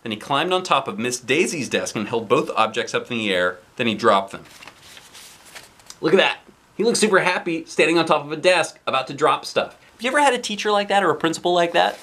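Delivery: ordinary delivery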